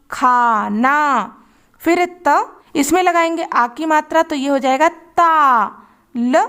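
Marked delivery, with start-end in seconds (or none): 0.1-1.3 खाना stretched out very slowly; slow blending demo for young readers. Shown as a demonstration. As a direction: read खाना slowly syllable by syllable. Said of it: "इन दोनों को एक साथ मिलाएंगे".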